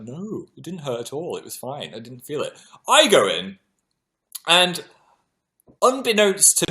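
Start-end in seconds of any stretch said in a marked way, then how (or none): 0.0-2.5 quiet casual Dan. Retold or imitated. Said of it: "He's like".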